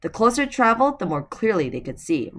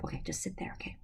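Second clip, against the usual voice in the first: soft whisper to settle her; whispered to dog; 3 semitones lower, 14 dB quieter, faster than usual. Real, not acted. Settling her dog on her lap in a soft whisper. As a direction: hush the dog onto your lap.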